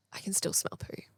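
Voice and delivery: "I can still smell poo" as a breathy unvoiced whisper; alarmed whisper on coming back into the room. Retold second realisation, whispered.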